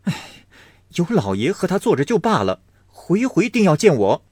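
quick, rising grumble; young master grumbling; complaining. Acted. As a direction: grumble while pulling on boots. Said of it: "一面抱怨道".

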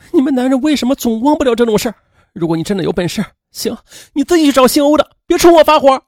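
high, tearful protest; crying. A narrator's reading. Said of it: "便哭泣着说". (哭喊着埋怨)

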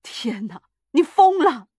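stunned start bursting into a loud high cry; appalled outburst; aghast shout. A narrator's reading. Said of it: "他喊着说， 吓呆了".

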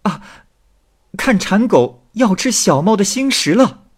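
very high, loud answering cry; calling out. A narrator's reading. Young woman calling back from the boat, high loud shout. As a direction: shout it back across the water, pitched right up. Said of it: "少妇一听也喊道".

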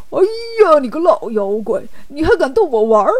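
acted outrage at being fooled; put-on oafish voice, affronted; indignant outburst. Bajie realizes he was mocked.

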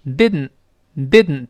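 didn't very slow, tongue tip stuck, air through the nose; nasal plosion, slow. Shown as a demonstration. Teacher slowly modelling nasal plosion in didn't. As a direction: drag out didn't with a nasal release. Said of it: "舌尖顶住，把它粘住".

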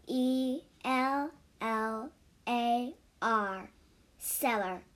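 slow letter-by-letter spelling. Shown as a demonstration. Teaching children the word cellar, slow spelling. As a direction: spell each letter slowly for learners. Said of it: "第一个单词cellar".

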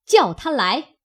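a touch of harshness; stern order. A narrator's reading. Fengjie sternly summons Xing'er, mildly sharp. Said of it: "凤姐儿厉声道".

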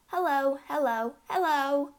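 high parrot hellos; parrot calling. A narrator's reading. Bird calls down the chimney to her, high parrot calling. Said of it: "the bird called down to her".

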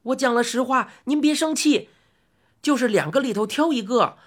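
high-pitched fretful boss; pleading shop boss. Acted. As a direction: beg to be put down. Boss placates angry old man.

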